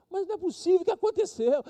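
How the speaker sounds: pastor's voice jumps high in disbelief; astonished pastor. Retold or imitated. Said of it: "o pastor". (pastor espantado com o jovem, bem mais agudo)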